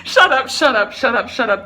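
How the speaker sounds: loud, rapid-fire disbelief; stunned disbelief. Real, not acted. Woman seeing her positive test, stunned disbelief. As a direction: blurt it out loud, unable to believe it. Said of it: "in complete disbelief".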